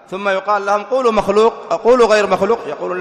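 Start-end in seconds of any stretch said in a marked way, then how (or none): 0.8-2.6 raised, insistent challenge, pitched up; pressing demand. Acted. Opponents challenge those who abstain on the Quran, insistent demand. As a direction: press them to commit, louder and higher. Acted challenge put to the doubters.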